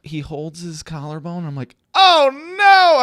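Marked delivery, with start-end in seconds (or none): 1.9-3.0 high, loud wail of dismay; dismayed cry. Retold or imitated. Fan watching his star player get hurt, dismayed cry.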